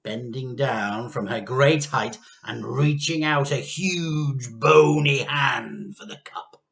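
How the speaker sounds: ordinary delivery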